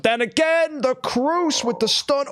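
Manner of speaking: very high, stretched-out match call; sudden high surge of excitement; excited play-by-play. Real, not acted. Caster calls a stun that turns the round, excited. Live match commentary at climax.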